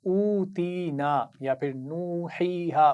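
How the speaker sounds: drawn-out, melodic tajweed recitation; slow Quran recitation. Shown as a demonstration. Teacher reciting slowly for pupils to repeat. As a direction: chant each syllable slowly for the class to copy. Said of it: "हरूफ मद्दा के सबक में एक लफ्ज़ देखा था".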